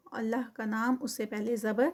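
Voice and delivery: usual speaking voice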